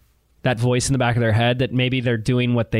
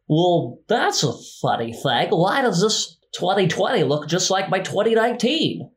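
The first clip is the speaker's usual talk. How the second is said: puzzled quote, lifting higher at the end; lightly acted bewildered voice; mock puzzlement; 4 semitones higher than usual. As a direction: wonder aloud in mock bafflement. Acting out puzzled look back at year.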